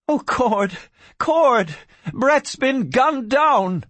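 high-pitched, anguished outcry; distressed cry. A narrator's reading. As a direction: cry out the terrible news. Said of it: "Barbara Kincaid cried".

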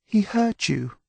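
put-on a high, anxious young woman; Delphine's voice. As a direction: Delphine fretting over the mule, high and anxious. Delphine protects the mule.

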